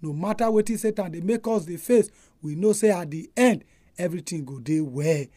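softly spoken prayer. Real, not acted. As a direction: pray it earnestly and gently. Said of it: "May we pray".

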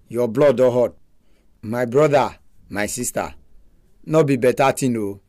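ordinary delivery

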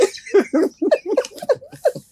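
high, delighted laugh. Real, not acted. Mother laughing at her child's words. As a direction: burst out laughing.

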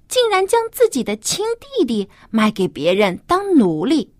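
usual speaking voice

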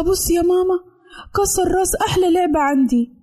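put-on high, whiny little-girl plea; tearful complaint. A narrator's reading. Daughter telling mother her doll was broken, tearful and whiny. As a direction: complain to mum as if about to cry.

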